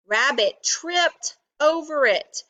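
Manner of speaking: ordinary delivery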